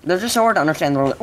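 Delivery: ordinary delivery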